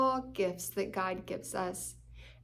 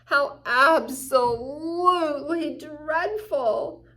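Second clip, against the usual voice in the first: higher, louder, slow theatrical despair; hammed-up gloom; 3 semitones higher, 8 dB louder, slower than usual. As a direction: wail it like the world is ending. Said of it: "Pessimistic means".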